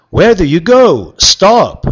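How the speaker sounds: high, loud call; shouting after her. Acted. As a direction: shout after someone running away. Prince calling after Cinderella.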